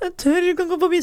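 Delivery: high, frightened pleading; retold scared whimper. Alone on the stairs, she whimpers high and scared. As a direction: sound too scared to move. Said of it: "så står jeg nede i trappa og gråt".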